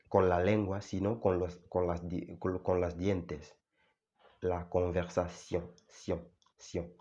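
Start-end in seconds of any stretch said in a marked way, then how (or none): 5.9-6.9 sion, sion said quiet and close; close demo. Shown as a demonstration. Teacher shows learners the mouth position, quietly. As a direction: nearly breathe sion, sion. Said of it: "os fijáis en mi boca".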